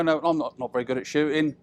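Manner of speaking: ordinary talk, nothing marked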